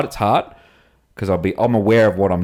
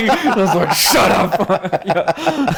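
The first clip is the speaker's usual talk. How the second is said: laughing; much slower than usual. Real, not acted. Laughing at Clint's teasing text.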